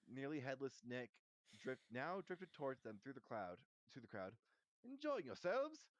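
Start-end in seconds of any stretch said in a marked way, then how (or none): 4.8-5.9 high, loud, slow inquiry; genial ghost host. A narrator's reading. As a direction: check on guests in a lofty drawn-out voice. Nick greets guests.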